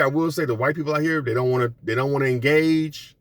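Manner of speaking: ordinary delivery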